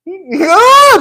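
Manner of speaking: loud, high hammed-up cry; mock wail. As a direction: wail in exaggerated distress. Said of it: "what the fuck you crying for".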